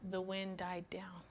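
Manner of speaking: hushed, whispered; whispered calm as the wind dies. Acted. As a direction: let it settle into a whisper. Calm after the storm.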